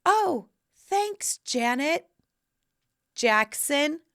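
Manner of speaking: high, drawn-out awed thank you; starstruck thanks. Retold or imitated. Young guest thanks [name], high and starstruck. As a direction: say her full name in awe. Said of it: "And I'm like".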